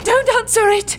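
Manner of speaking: loud, high, frantic Don't answer it; frantic cry. Acted. Evangeline begging Finley not to answer the call, frantic shout. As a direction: shout in sudden panic to stop him. Panics as the screen calls.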